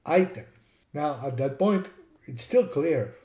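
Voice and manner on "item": item said "EYE-tem"; English pronunciation. Shown as a demonstration. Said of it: "Instead, in English, it became".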